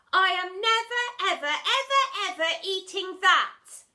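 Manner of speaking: loud high refusal; adamant shout. Acted. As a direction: refuse with all her might. Girl adamant.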